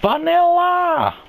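high, slow whiny demand; high bratty girlfriend. Acted. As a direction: drag out the whine like a spoiled brat. Snooty customer ordering.

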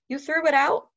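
high, loud outraged question; shocked and angry, voice jumping up; mad. Acted. Boy to his dad about the thrown-out helmet, outraged. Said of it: "saw how mad I was".

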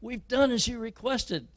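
high, loud, slowing plea to open the door; desperate pleading. Acted. Exhausted prisoners beg their jailers, high and desperate. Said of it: "They'll get hoarse, and they'll say".

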